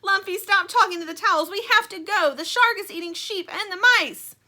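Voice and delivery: high, loud hurried plea; urgent Stingray. Acted. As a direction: hurry everyone out. Stingray urging Lumpy.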